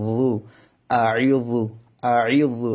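যু with a thick, emphatic Arabic Za; heavy ZA. Shown as a demonstration. Teacher drills students on the thick letter, heavy যু. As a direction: make the যু in আ ই যু heavy and full. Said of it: "য মোটা হবে".

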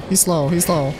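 normal talking voice

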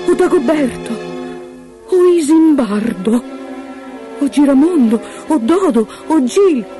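high, loud hopeful guesses with pauses; tentativi ansiosi. Acted. Regina tira a indovinare, ansiosa. (nomi tentati con ansia, voce acuta)

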